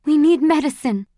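high, loud plea; pleading demand; desperate insistence. A narrator's reading. Insists again on urgent need.

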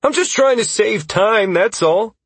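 high, loud protest; defensive backing down. A narrator's reading. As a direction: excuse yourself while giving in. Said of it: "threw his hands up in defeat".

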